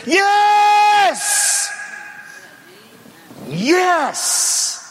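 slow, high triumphant shout. Real, not acted. Preacher answering the mockers, triumphant shout. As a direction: proclaim it to the rafters.

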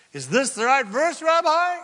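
high, loud eager question; a keen student checking with his rabbi; a student calling up to the rabbi. Retold or imitated.

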